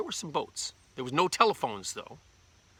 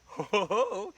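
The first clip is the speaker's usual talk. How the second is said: raised-pitch mischievous "ho ho ho"; sneaky chuckle; 7 semitones higher, 4 dB louder, slower than usual. Acted. Daddy creeping up with the magic wand, sneaky chuckle. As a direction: pretend trickster's giggle.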